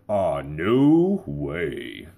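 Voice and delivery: very slow, drawn-out smooth announcer refusal; husband dodging the diaper in voiceover tone; stuck radio voice. Pretend. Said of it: "your voice is stuck".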